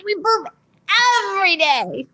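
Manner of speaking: put-on high kid reciting the rule; child voice. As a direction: recite it high like a small boy. Son repeating rule.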